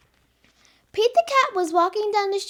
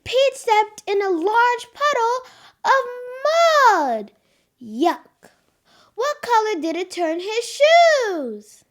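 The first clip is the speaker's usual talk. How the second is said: louder, higher storybook voice; lively read-aloud; 4 semitones higher, 4 dB louder, slower than usual. Acted. Girl reads Pete the Cat to preschoolers. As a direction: read with big excitement for kids.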